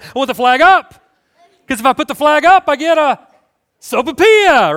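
high, loud yell building to a wail; hammed-up child hollering to get his way; imitated kid tantrum. Little kid demanding sopapillas from mom, hammed-up tantrum yell.

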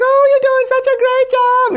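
very high, loud squeal of praise; hammed-up high-pitched cheer; excited mom cheering. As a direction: imitate a thrilled mom cheering on her kid. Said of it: "she was so excited".

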